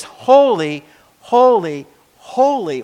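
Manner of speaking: preacher hammering "holy" three times; emphatic repetition. Real, not acted. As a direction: hit every "holy" as its own high beat.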